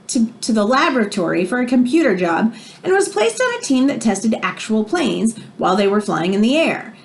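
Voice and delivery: expressive read-aloud storybook voice; reading to children. Acted. Teacher reading a biography to her class, expressive read-aloud. Reads picture book to class.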